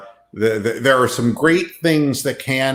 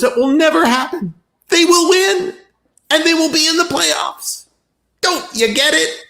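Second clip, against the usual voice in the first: exasperated. Real, not acted. Exasperated rant at viewers.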